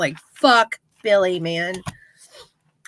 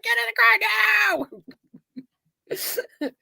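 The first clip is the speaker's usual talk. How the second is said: higher, drawn-out urgent yell; shouting; 9 semitones higher, 4 dB quieter, slower than usual. Retold or imitated. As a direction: yell the order, stretched out. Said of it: "He's like".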